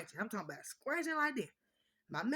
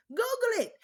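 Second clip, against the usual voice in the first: emphatic shout; 13 semitones higher, 8 dB louder than usual. Real, not acted. Telling viewers to look it up.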